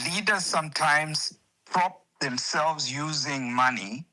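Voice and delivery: ordinary talk, nothing marked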